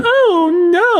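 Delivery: high squealed mock dismay; mock gasp. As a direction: feign horror, hammed up. Mock shock at accusation.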